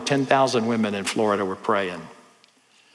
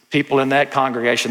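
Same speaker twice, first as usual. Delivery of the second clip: ordinary talk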